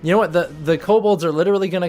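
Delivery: usual speaking voice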